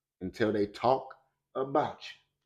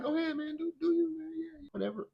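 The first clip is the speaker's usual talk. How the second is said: lazy, careless Faith; 10 semitones higher, 6 dB quieter than usual. Acted. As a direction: play Faith as a bored slacker shrugging it off. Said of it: "Faith is like".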